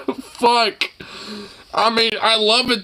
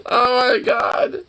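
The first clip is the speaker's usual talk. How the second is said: ordinary talk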